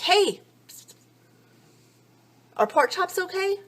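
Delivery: high, sing-song pestering question; mock eager. Wife pestering husband in living room, high eager question. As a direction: pop in brightly asking.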